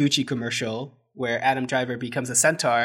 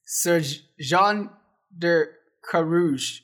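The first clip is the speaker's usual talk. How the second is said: lightly French "Sir Jean de Carouge", slow and drawn out; a hammed-up French accent on "Carouge", slow; French pronunciation; 3 semitones higher, much slower than usual. Shown as a demonstration. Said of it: "You wanna hit him with the French?".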